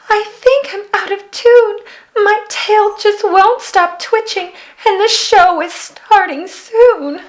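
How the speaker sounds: high, anxious stage-fright voice; nervous mermaid. A narrator's reading. Mermaid confesses stage fright to the girl, high and nervous. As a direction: fret like a jittery young singer. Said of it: "mumbled nervously".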